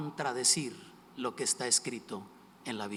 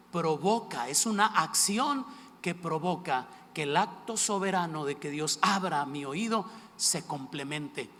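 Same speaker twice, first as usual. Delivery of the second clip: ordinary talk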